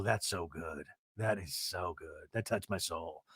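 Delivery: low, soft, slow rapture; whisper-quiet awe at the drink; hushed savouring. Retold or imitated. Mary tasting shandy, low hushed rapture.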